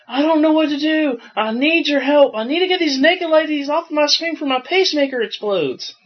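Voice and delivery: put-on panicked old caller. Tech support worker imitates a panicking old caller, high and frantic. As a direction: squeal it like a panicking old man. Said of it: "freaking out, really upset".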